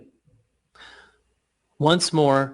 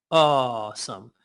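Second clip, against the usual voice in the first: awesome slow and lower, aw held long; 4 semitones lower, slower than usual. Shown as a demonstration. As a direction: let awesome sink lower. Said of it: "Say awesome".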